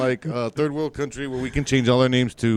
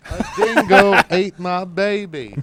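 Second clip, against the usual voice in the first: put-on slower, laughing Australian accent; mock Australian accent; 4 semitones higher, 3 dB louder, slower than usual. Friend answers with a laughing Australian accent. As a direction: drawl it out as a joke Aussie. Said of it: "I wish I could do Australian accents".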